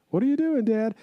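put-on high-pitched, clueless child voice; imitated kid. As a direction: play an innocent kid asking Dad. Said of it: "that kid's like every other kid".

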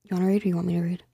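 quick hushed aside; whispering. Real, not acted. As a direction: whisper it fast to your cohost. Aside to cohost off-mic.

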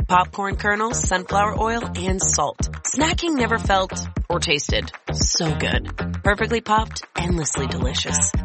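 ordinary talk, nothing marked

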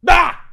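sudden loud "Ah!" to scare; short, high jump-scare shout; startling yell. Real, not acted. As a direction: burst out to make them jump. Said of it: "what if I scare you?".

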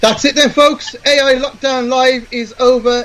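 high, drawn-out announcer call; show sign-off. Real, not acted. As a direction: announce the big finish to the audience.

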